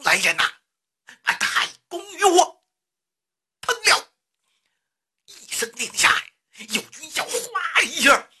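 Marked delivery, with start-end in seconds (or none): 0.0-4.1 high, forceful order climbing at the end; roared command. A narrator's reading. Enraged Xiang Yu ordering his men to seize the old man, roared command. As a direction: bellow the order, pitching the last words high. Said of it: "他大吼这么一声".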